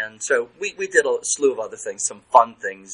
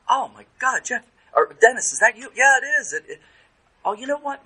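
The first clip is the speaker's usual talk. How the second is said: patient surprised; 13 semitones higher, 4 dB louder than usual. Retold or imitated. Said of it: "I said to therapist".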